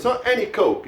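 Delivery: any lifted high, Coke dropping after it; contrastive stress on any. Shown as a demonstration. Teacher explains why it isn't just any drink, stressing any. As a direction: punch any to set up a contrast. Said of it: "it's diet".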